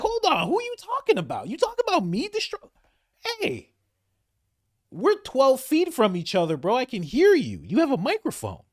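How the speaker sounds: hammed-up offended who me; mock Bibi. Bibi protesting to Trump across the room, high indignant mock. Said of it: "Bibi's like".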